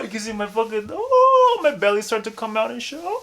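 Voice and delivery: straining up into a very high head voice; head voice demo. Shown as a demonstration. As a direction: push the note way up high, belly out. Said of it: "for example".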